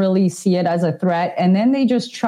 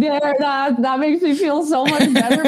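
higher, laughing through mock gratitude; laughing relief; 7 semitones higher than usual. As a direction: laugh it out, half-joking thanks. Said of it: "I'm like".

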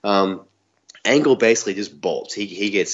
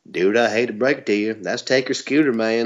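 Mideon's bad accent; 3 semitones higher than usual. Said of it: "accent was bad. He, he goes, I'm, I'm trying to do this justice".

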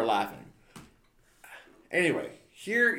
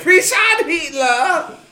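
high, loud mocking heckle; mock cheer; 14 semitones higher, 10 dB louder, much slower than usual. Heckling his friend's beer read with a loud jeer. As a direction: egg him on with a jeering shout.

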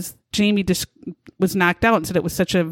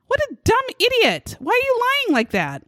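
exasperated outburst; 13 semitones higher, faster than usual. Real, not acted. Host mocks lying witness.

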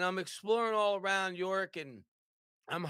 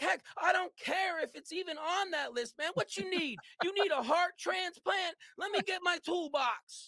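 much higher, shouted sales pitch; frantic excitement; 11 semitones higher, 3 dB louder than usual. Retold or imitated. Salesman desperate for his first sale, high frantic shouting. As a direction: go over the top, desperate to sell. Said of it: "So I'm getting excited".

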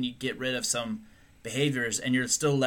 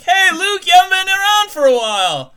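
loud greeting; 12 dB louder than usual. Pretend. Acted bar crowd greeting Luke.

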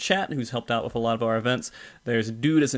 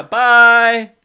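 loud bye; 10 semitones higher, 14 dB louder than usual. Real, not acted. Loud goodbye to stream.

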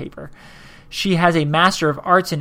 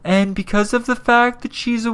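mock listener accusation; 7 semitones higher, slower than usual. Voices listeners accusing him.

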